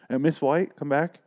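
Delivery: lightly acted call to a departing shopper; calling after customer. Saleswoman calls customer back.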